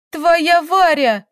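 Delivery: high, loud, drawn-out jealous wail; shrieking with jealous anger; angry cry. A narrator's reading. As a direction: shout it spitefully, stretching the words. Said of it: "закричала Таисия, разбрызгивая злые слёзы".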